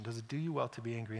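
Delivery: God gently questioning an angry Jonah; soft, gentle question. Acted. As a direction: ask it softly, like a patient father. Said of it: "He asked him a simple question".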